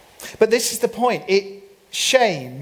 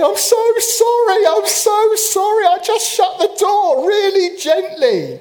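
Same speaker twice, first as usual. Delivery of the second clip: much higher, louder squeaky mock wife voice; apologetic wife; 13 semitones higher, 6 dB louder than usual. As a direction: imitate his wife pleading sorry in a high voice. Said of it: "My darling wife was sitting slumped, going".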